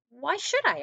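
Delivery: high, loud huffy retort; high squeaky turtle; indignant turtle. Acted. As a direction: turtle bristles at being sent away. Turtle voiced in story.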